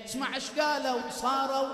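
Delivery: loud, high impassioned sermon; full-force preaching. Acted. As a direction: preach it out to the mourners.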